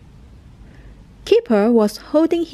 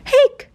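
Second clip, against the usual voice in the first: sharp high hiccup sound; a little squeaky hic; 14 semitones higher than usual. Acted. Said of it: "Keeper still had hiccups".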